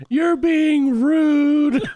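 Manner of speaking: high, loud, drawn-out mock wail; hammed-up sobbing protest, stretched very long; imitating crying child. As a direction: wail it like a tearful kid, exaggerated. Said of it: "he was crying".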